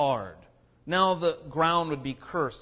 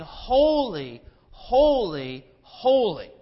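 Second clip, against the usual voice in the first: loud, ringing call of holy; solemn proclaiming; 4 semitones higher, 7 dB louder, much slower than usual. Retold or imitated. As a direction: proclaim it like seraphim round the throne. Seraphim crying out to God.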